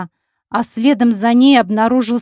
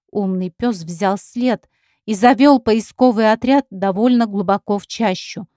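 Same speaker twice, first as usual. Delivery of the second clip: ordinary delivery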